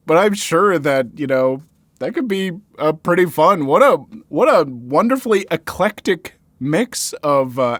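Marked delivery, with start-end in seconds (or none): none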